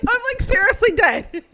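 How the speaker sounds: loud, high fangirl outburst; excited outburst. Retold or imitated. Fan reacts to idol sipping her cup, loud outburst. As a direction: burst out, overwhelmed and high-pitched.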